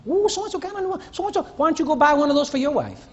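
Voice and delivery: high, gushing gossip; gossiping husband. Retold or imitated. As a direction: coo it like a nosy admirer. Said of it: "You're always commenting".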